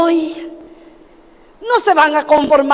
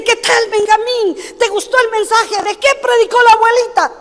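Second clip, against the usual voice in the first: put-on parents asking child; 6 semitones higher than usual.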